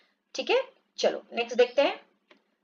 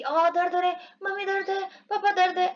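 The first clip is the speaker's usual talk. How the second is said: put-on whiny child; 6 semitones higher than usual. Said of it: "आप जैसे बोलते हो ना".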